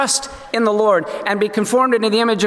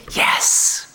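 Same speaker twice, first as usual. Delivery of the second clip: whispered yes. Acted. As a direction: barely voice it, tender confession. Joseph answers his brothers.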